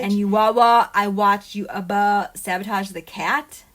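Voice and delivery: mock wailing. Mock crying imitation of someone.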